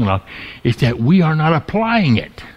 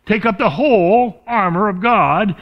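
slow, higher delivery with "whole" drawn out; stress on "whole"; 4 semitones higher than usual. Shown as a demonstration. Preacher reading the verse to his congregation, stressing "whole". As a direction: give "whole" deliberate weight and length. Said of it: "the emphasis is on the word whole".

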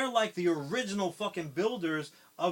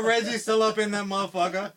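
higher, louder excited blurt; loud acted line; 5 semitones higher, 10 dB louder than usual. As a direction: shout it like breaking bad news. Acted reply about Reggie.

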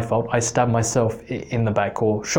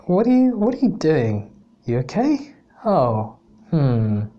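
high-pitched, hammed-up puzzlement; doubtful thinking; 5 semitones higher than usual. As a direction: voice the teacher's wary second thoughts, dropping to a hum and rising again. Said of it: "that look when you're thinking".

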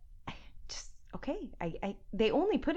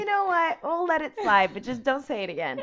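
hammed-up lenient lawyer; 5 semitones higher, 6 dB louder than usual. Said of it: "it's like".

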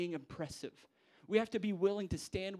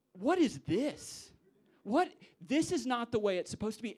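louder and higher, shocked; disgusted outcry; 7 semitones higher, 6 dB louder than usual. Retold or imitated. Wedding guests appalled at the best man, loud and shocked. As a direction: cry out in disbelief. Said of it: "Everybody would be disgusted, going".

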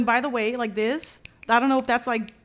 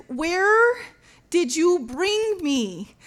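high, slow, emphatic demand; mad, frustrated; 6 semitones higher, 4 dB louder, much slower than usual. Retold or imitated. Wife confronting husband about the move, mad and frustrated. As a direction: draw out each word in exasperation, pitched up. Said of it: "I'm just so mad".

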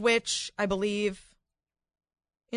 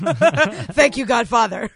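laughing thanks; 7 dB louder, slower than usual. Real, not acted. Teasing caller as mafia don.

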